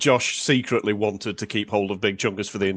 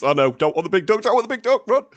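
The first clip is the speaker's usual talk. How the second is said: building from raised voice to high squeal; mock panic; 11 semitones higher, 4 dB louder than usual. Player joking about the hot potato game, mock panic. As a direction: play up comic alarm, climbing higher. Acting out players fleeing the duck.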